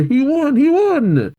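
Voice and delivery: high-pitched excited cheer; gleeful squeal of victory at normal volume. Acted. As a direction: cheer for a friend's win. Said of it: "all cheer".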